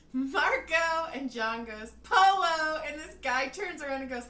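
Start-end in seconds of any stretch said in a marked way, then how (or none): 0.1-1.1 high, loud searching call; loud Marco Polo call. Retold or imitated. Retold game of Marco Polo. 2.0-2.8 high, very loud answering call; loud Marco Polo answer; calling back. Retold or imitated. Retold answering call in the game.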